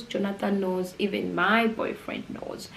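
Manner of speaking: usual speaking voice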